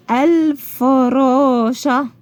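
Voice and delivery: الفراشة very slowly, syllable by syllable, L sounded; pronounced lam. Shown as a demonstration. Teacher showing that lam is spoken, slow syllables in الفراشة. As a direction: stretch out الفراشة with a clear L before the f. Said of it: "أنا نطقت اللام أهي".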